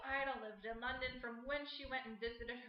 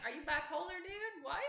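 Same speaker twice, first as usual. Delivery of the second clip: incredulous; 5 semitones higher, 3 dB louder than usual. Retold or imitated. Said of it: "I'm like".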